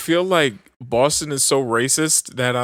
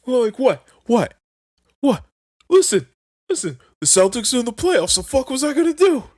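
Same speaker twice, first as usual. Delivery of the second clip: put-on indignant drunk; 10 semitones higher than usual. Drunk Mikey defends himself.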